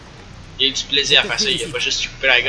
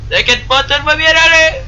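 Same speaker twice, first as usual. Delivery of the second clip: voix rassurante moqueuse; 7 dB louder than usual. Pretend. Taquine son ami en jeu.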